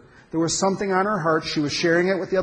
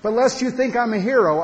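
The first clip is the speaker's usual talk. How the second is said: usual speaking voice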